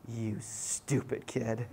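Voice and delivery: low, soft, very slow disbelief; suppressed contempt. Retold or imitated. Youth worker silently judging the crying boy, contemptuous. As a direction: drag it out under your breath, disgusted.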